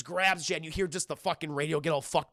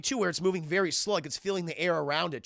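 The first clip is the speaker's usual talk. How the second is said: ordinary talk, nothing marked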